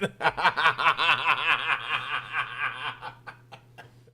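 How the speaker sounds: long loud laughing fit; laughter. Real, not acted. Laughing at the request. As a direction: keep laughing helplessly.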